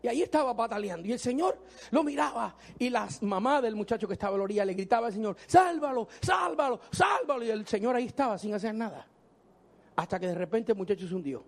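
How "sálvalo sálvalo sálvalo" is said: frantic plea. Retold or imitated. Mother calling from the shore.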